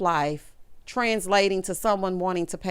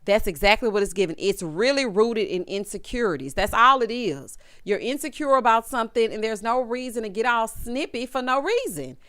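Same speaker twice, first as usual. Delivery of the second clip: ordinary talk, nothing marked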